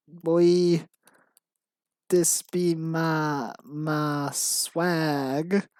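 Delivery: slow mock gangster, drawn-out words; hammed-up gangster, word by word. Writer reading out own embarrassing script, mock gangster. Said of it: "Why am I talking like this?".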